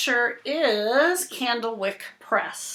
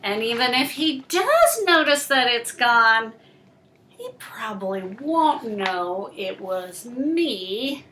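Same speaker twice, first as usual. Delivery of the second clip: slow, dramatic picture-book reading; reading to children; 2 semitones higher, 7 dB louder, slower than usual. Acted. Reading the fish story to Cooper, slow and dramatic. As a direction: draw the little listener into the story.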